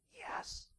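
put-on whispered child's answer; little kid's voice. Little kid answers the irritated boss, whispering. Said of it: "this little kid's voice".

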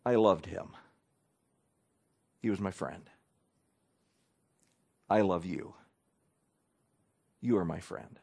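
low, soft, gentle comfort; offering comfort to a grieving friend; tender condolence. Shown as a demonstration. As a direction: speak it softly to the grieving. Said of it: "You say".

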